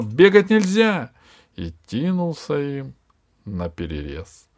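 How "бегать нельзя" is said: высокий громкий запрещающий окрик; рявкнуть запрет; крик. Acted. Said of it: "вдруг ожил и закричал". (мудрец Кошмар кричит бегущим, громко и высоко)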